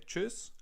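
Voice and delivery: Tschüss said high, the German way with a rounded ü. Shown as a demonstration. Teacher modelling Tschüss to learners, high and crisp. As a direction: pitch up and say Tschüss with a crisp tsch and short ü. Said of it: "You could either say".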